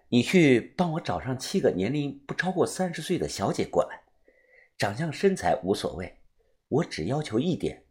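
hushed, measured voice of a doctor giving orders; demanding doctor. A narrator's reading. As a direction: give secret instructions softly but firmly. Doctor's voice in story.

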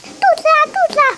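put-on high, fast childish wail; boy cries it hurts, high and fast; whining child. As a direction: cry out in pain like a little kid. Child answers mother in pain.

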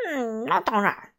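拖慢了含含糊糊地答应; 慢吞吞、口齿不清地应一声; 含糊不清. A narrator's reading. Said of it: "含糊不清地说". (含糊不清地嘟囔着说)

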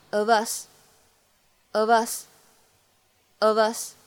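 "of us" very slow, v linked into "us"; linking. Shown as a demonstration. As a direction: run "of us" together, slowly. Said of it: "会有一个非常自然的连读". (of us连读，慢速示范)

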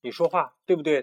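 ordinary delivery

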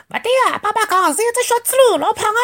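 put-on very high, quick childlike voice; little cub voice. A narrator's reading. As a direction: rattle it off like a tiny know-it-all. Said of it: "第三只小物事讲".

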